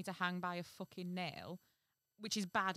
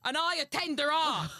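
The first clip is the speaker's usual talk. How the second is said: loud, high, hammed-up Chatham fairy; exaggerated Chatham voice; Chatham accent; 9 semitones higher, 12 dB louder than usual. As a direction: belt it out in a comic Chatham accent. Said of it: "Give one of 'em a Chatham voice".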